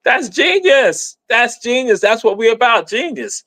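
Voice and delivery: excited proclaiming of admiration; emphatic excited quote. Acted. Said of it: "So that's why I'm like".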